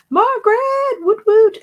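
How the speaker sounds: high, loud woot-woot cheer. Real, not acted. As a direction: cheer her on like a fan. Cheering a viewer in live chat.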